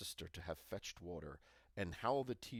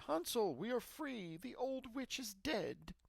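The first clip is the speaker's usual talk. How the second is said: put-on a girl telling her brother they are free, overjoyed; joyful cry; 13 semitones higher than usual. A narrator's reading. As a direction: burst out with relief and joy. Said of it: "and cried".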